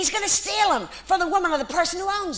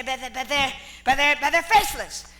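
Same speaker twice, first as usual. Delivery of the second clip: ordinary delivery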